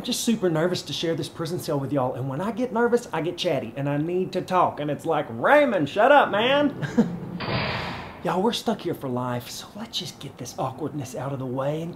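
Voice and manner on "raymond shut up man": high, loud mock shout; fed-up shout, pitched way up; exasperated yell. Acts out exasperated people yelling at him.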